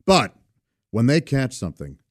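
usual speaking voice

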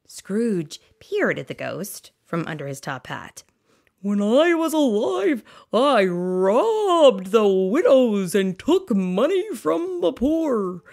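loud, booming spectral voice; ghost voice. Acted. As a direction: moan like a guilty ghost. Voicing Marley's ghost.

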